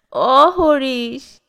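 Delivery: very slow, drawn-out whimpering call; a helpless child calling its father; crying call. A narrator's reading. Old grandfather crying out to his son, drawn out and whimpering. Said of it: "কান্নার মধ্যে ডাকলেন".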